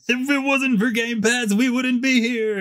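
high-pitched mocking developer voice; mock game developers. Host mimicking the developers of a cancelled game, high mocking impression. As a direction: imitate the developers in a sustained high put-on voice. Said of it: "Hitman developers like".

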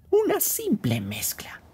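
whispered aside, secretive and sly. Acted. Host whispering about the spiked coffee, sly whisper. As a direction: whisper it so the guest can't hear.

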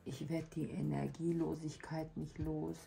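usual speaking voice

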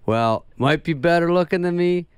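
boastful retold brag, slowed down; cocky Farley. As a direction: deliver it like a smug comic who's killing. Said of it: "he goes".

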